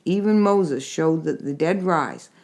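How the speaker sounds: usual speaking voice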